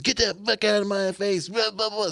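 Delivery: a retold furious wife, lightly voiced; mock angry wife. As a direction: throw the wife's hostility back. Wife cussing customer out, quoted.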